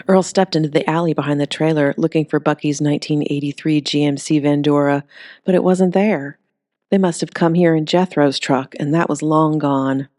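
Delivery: plain reading voice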